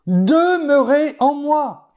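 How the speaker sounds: slow, high and drawn-out, each word weighed; preacher voicing Christ's command, high and slow; solemn command. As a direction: proclaim the Lord's words slowly from on high. Quoting the Lord's command.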